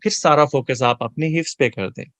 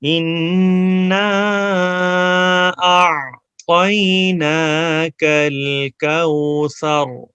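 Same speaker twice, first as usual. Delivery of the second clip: Quran recitation; 2 semitones higher, much slower than usual. Shown as a demonstration. Teacher recites the verse slowly for students to repeat. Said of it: "اب زبانی پڑھنے".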